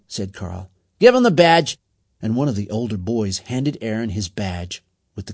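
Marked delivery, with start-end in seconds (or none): 1.0-1.8 loud, high gang-leader call; commanding. A narrator's reading. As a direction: call it out like a boss. Gang leader giving orders.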